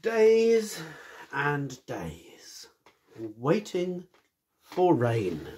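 slow, dramatic opening of the tale; storybook narration. A narrator's reading. Storyteller to children, slow and dramatic. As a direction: draw the listeners in slowly.

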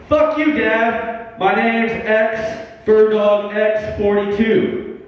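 high, slow, drawn-out defiance; defiant kid. Acted. Acted teenage defiance toward a father.

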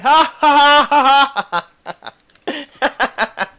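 loud hammed-up evil cackle; evil laugh. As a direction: cackle like a villain. Mock villain laugh.

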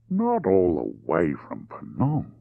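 drawn-out, deliberate question; unhurried drawl; slow drawl. Acted. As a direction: stretch the words as you ask. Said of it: "He spoke deliberately in a slow drawl".

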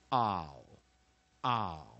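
"ow" as a loud, falling diphthong; strong-syllable OU sound. Shown as a demonstration. Teacher showing students how OU reads in strong syllables, "ow" drawn out and emphatic. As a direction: say "ow" twice, separated and emphatic. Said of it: "在强音节中它可以念".

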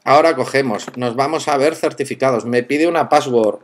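usual speaking voice

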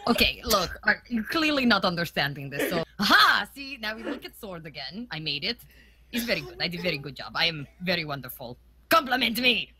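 low, self-satisfied revolutionary leader; communist revolutionary, boastful. Acted. Revolutionary showing off a sword and demanding compliments, boastful. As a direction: boast in a low voice, then get serious.

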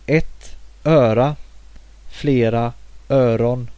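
careful Swedish: äpplen, bin, ögon, öron; teacher modelling Swedish n plurals; Swedish demo words. Shown as a demonstration. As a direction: model each n plural for learners. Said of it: "are inflected to n in plural".

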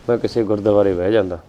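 soft heartbroken sigh; sad lament. Acted. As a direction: say ਗੁਰਦੁਆਰੇ ਬਹਿ ਜਾਂਦਾ like a sorrowful wish. Same lament, quiet regret.

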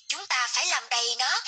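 normal talking voice

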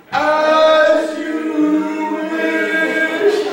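high, loud, long singsong call; drawn-out mock cry. Man rolling downhill calling to his beloved, drawn-out mock cry. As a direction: call it out as if tumbling away downhill. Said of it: "the second bounce he goes".

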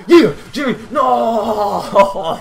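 loud high yell into a laughing "No!"; real cry of dismay turning to laughter; loud shout. Real, not acted. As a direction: yell at the near miss. Teammate dies at the last moment.

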